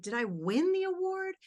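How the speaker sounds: asking it like it can't be true; incredulous question. Retold or imitated. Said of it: "so I said".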